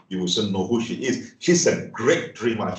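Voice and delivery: normal talking voice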